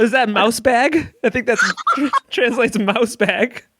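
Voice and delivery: giggling through the words; laughing. Real, not acted. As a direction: laugh as you puzzle it out.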